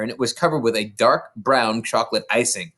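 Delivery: ordinary delivery, nothing marked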